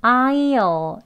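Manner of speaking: I'LL drawn out as "ai-ul", full I kept; slow, full "I'll" with the tongue barely curled; basic contraction. Shown as a demonstration. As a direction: stretch "I'll" into a long ai plus dark l. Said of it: "이제 기본 발음이에요".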